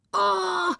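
high, drawn-out furious "oh"; angry cry. A narrator's reading. Father at children left hanging, angry cry. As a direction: exclaim in high-pitched outrage, without much volume. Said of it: "schrie der Vater zornig".

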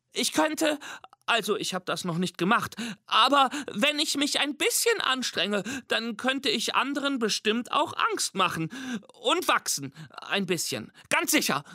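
timid, high squeaky monster, hesitant; Mimi. A narrator's reading. Anxious little monster promising to try being scary, squeaky and hesitant. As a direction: offer unsurely in a nervous piping voice. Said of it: "kleinen, gelben, ängstlichen Monster mit Piepsstimme".

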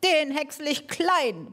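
the mower man mildly hamming up a roar; bellowed threat. Acted. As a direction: threaten the animals gleefully. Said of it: "brüllte er".

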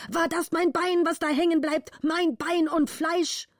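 high, outraged cry, slowing at the end; the furious count shouting up at his daughter; angry father. Acted. Count shouting up at Gritta, high outraged cry. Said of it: "schrie der Vater zornig".

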